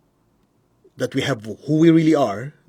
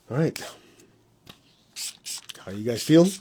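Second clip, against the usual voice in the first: ordinary delivery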